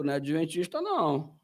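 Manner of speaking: soft, low thinking to himself; muttered aside. Retold or imitated. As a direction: murmur it under your breath. Same muttered thought again.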